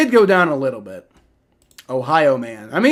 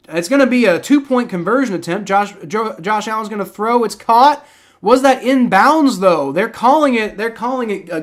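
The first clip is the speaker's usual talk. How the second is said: higher voice, building louder at the catch; live play-by-play; 6 semitones higher, 5 dB louder than usual. Real, not acted. Streamer calls a two-point conversion attempt, excited play-by-play. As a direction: call the attempt as it happens, excitedly.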